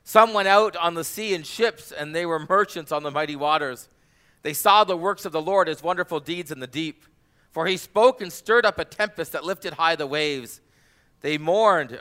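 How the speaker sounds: loud, high proclaiming voice; dramatic scripture reading. Acted. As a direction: read the psalm like a storm rising. Reads Psalm 107 dramatically.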